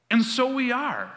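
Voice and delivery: first bold take, voice lifted high; emphatic declaration. Shown as a demonstration. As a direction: ring it out as a bold claim. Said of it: "repeated verse, first take".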